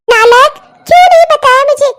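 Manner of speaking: loud, high, tearful rebuke; angry scolding. Acted. Mother berating her son again, loud and tearful. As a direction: scold him with a catch of tears.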